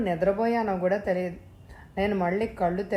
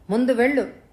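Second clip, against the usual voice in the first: a loud, higher hysterical shout; 5 semitones higher, 6 dB louder than usual. A narrator's reading. The sister shouting at her brother to throw him out, loud and hysterical. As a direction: yell the order at him. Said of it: "గట్టిగా అరిచాననే అనుకున్నాను".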